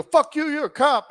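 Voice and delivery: high-pitched suspicious retort; hostile mock accusation. Driver voicing men in the SUV suspecting him, high hostile retort. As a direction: imitate strangers throwing an accusation.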